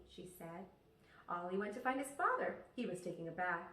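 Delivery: ordinary delivery, nothing marked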